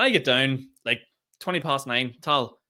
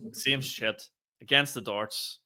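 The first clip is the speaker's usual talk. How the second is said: ordinary talk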